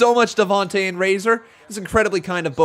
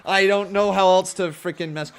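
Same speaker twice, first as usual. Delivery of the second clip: ordinary talk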